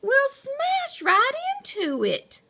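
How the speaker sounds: very high-pitched character panic; alarmed protest. A narrator's reading. Mo fears smashing into the ramp, high alarmed voice.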